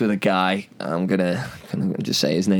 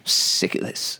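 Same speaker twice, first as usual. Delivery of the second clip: whispering; 4 dB quieter, slower than usual. Pretend. Repeated mock whisper.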